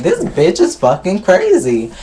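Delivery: mock disbelief. Voices Mimi's stunned look.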